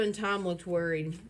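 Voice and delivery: usual speaking voice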